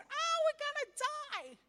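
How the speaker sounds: hammed-up panic, voice shooting way up; panicked freak-out. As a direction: act out a terrified Israelite crying the alarm. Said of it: "looks back and he freaks out".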